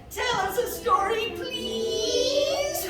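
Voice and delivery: high, slow, begging little voice; pleading baby elephant. A narrator's reading. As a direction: beg sweetly like a tiny elephant. Baby elephant begs again.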